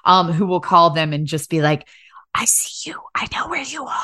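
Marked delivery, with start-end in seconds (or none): 2.4-4.0 creepy whispered threat; whispering killer. Acted. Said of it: "ambiguous gender whispering killer".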